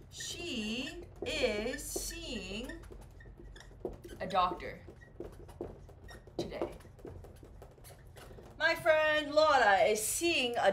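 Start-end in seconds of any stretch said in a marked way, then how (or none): 0.1-6.7 slow, drawn-out example. Shown as a demonstration. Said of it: "if I say".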